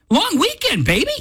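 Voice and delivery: long weekend crowed up high; gleeful high cheer; a thrilled host whooping it up; excited. Real, not acted.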